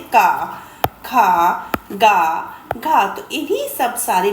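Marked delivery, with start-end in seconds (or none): 0.0-3.1 each syllable का, खा, गा, घा said separately with a long open aa; clear syllable demo. Shown as a demonstration. Teacher to class, showing का खा गा घा with a clear, drawn-out aa. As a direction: sound out का खा गा घा so the aa rings. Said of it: "तो हम क्या बोलते हैं?".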